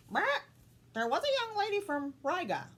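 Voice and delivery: high squawking parrot voice. Acted. Parrot squawks a rhyme from the rafters, high and shrill. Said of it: "squawked the parrot".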